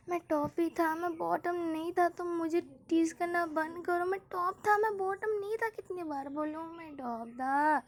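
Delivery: high, whiny insistence, close to tears; crybaby protest. A narrator's reading. V insists to teasing friends, high whining.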